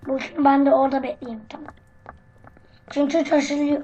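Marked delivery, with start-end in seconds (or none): none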